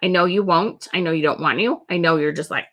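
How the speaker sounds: normal talking voice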